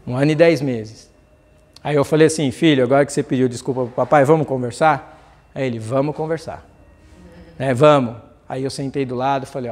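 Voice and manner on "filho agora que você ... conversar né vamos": pai carinhoso. Retold or imitated. Pai conversa com filho pequeno.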